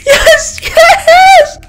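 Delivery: very high, pleading turning to cracking laughing yeses; laugh-crying ecstasy. Real, not acted. Streamer winning the clutch match, laugh-crying high yeses. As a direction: break into sobbing, laughing joy.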